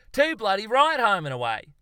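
loud, high, jubilant confirmation; gleeful shout. A narrator's reading. Zoologist hears the right answer, gleeful shout. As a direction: shout it in delighted triumph. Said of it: "Charles shouted gleefully".

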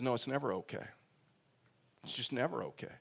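quiet, earnest, gently insisting; soft sincere reassurance. Retold or imitated. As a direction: softly tell her it is never okay.

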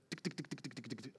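quick light ticking noises with the tongue; soft rapid tick-tick-tick of little feet; tongue-clicked patter; imitated pattering footsteps. Imitates footsteps creaking upstairs.

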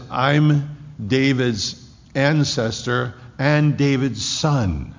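drawn-out, deliberate scripture reading; slow emphasis. Real, not acted. Preacher stressing Jesus's claim to the church. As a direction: lean on every word slowly.

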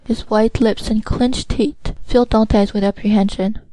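usual speaking voice

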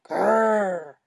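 dinosaur growl. Pretend. Pretend dinosaur growl in play.

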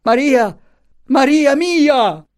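very high cry, stretched at the end; anguished high-pitched call; desperate cry. A narrator's reading. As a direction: cry out for your child. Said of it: "ebbe ancora la forza di gridare".

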